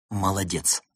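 plain reading voice

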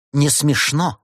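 unamused actress; curt rebuff. A narrator's reading. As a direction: shut it down sharply. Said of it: "отрезала актриса".

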